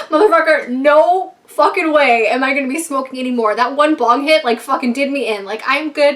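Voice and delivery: loud, emphatic inner protest; retold exasperated outburst; mock indignant refusal. Retelling indignant inner refusal to friends.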